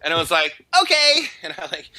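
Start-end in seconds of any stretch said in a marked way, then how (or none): 0.8-1.2 put-on high, loud small boy's answer; small boy eagerly agreeing to fetch the girls; eager little boy. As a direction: chirp it like an excited six-year-old.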